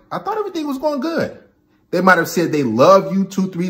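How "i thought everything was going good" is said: high, fast, bewildered; mock confused guy. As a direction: blurt it out puzzled. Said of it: "you're like".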